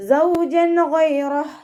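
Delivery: high, slow chanted verse; recitation demo. Shown as a demonstration.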